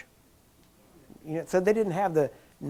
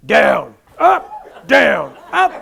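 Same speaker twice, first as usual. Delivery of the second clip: imitating Jack Coe; 2 semitones lower, 12 dB louder, much slower than usual. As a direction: bellow orders like a rough healer. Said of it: "and he goes".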